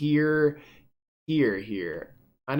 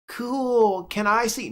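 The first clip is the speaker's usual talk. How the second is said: put-on high, bright child voice asking; a curious kid begging to look; eager little girl; 9 semitones higher, slower than usual. Clara asks her daddy to see his book, eager child voice.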